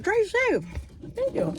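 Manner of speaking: very high, singsong imitation; imitated friendly send-off. Restaurant man seeing his customer off, high sweet imitation. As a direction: mimic a sweet goodbye up high. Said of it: "he was like".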